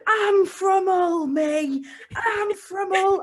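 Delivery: high, loud, drawn-out mock drunk shout; drunken shouting. Friend imitating Jess drunk in an Edinburgh courtyard, shouting. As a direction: bellow it slowly with drunken pride. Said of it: "you just kept shouting in the courtyard".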